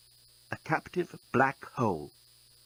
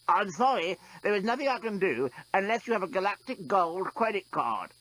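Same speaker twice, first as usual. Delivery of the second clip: higher robot voice, lightly strangled; a machine faking being strangled; simulated strangled voice; 7 semitones higher than usual. A narrator's reading. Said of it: "in a simulated strangled voice".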